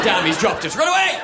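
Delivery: hammed-up cry to flee; mock panicked yell.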